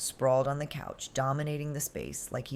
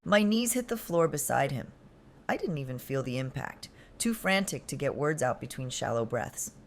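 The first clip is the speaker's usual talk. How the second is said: ordinary delivery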